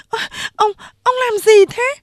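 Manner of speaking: very high startled yelp of words; startled protest. A narrator's reading. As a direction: protest in a high-pitched female voice. Startled worker after being groped.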